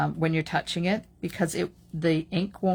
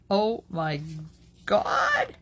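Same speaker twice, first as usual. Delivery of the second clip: amazed; 4 dB louder, much slower than usual. Real, not acted. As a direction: gush with delight. Admiring the result.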